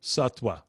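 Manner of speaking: "Satwa" as SUT-wa, with a w. Shown as a demonstration. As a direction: round the lips for a w in "Satwa". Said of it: "it's more like a W".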